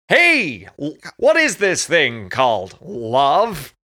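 high, slow beat poetry recital; Mike Myers beat poet. Retold or imitated. Quoting the movie's beat poet, slow and high. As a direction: drawl it like a beatnik poet. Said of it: "What was his thing?".

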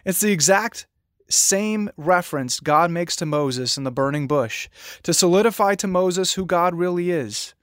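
ordinary talk

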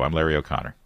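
normal talking voice

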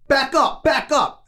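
high-pitched imitated driver's bark; mimicking an irate driver's command; barked order. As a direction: snap it out as an order, high and sharp. Driver shouting in retold standoff.

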